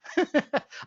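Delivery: short amused chuckle. Real, not acted. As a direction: chuckle briefly at your own boast. Amused by own gourmet lunch boast.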